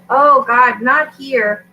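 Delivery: loud alarmed "Oh, God"; sudden dismayed outburst; startled. Real, not acted. Alarm about the sky.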